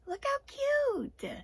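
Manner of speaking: high, slow squeal of delight; gushing. Real, not acted. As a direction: coo over something adorable. Gushing over a cute mask.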